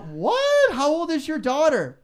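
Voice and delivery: high, loud shocked What, then a quick follow-up; a radio host yelping at a caller's news. Retold or imitated. A radio host retells his stunned question to a caller, high and loud.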